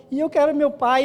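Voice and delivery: mais agudo e forte, imitando menino aflito; imitando criança chorando. Retold or imitated. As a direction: imite o menino perdido chamando pelo pai. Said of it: "e chorando". (imitação de criança chorando, sem choro real)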